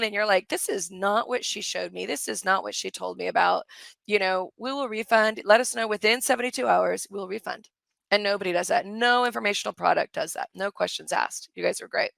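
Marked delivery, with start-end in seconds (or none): none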